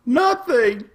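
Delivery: "Nothing." firm falling high; emphatic nothing. Acted. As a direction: land "Nothing." with a falling tune. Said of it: "Nothing is bad".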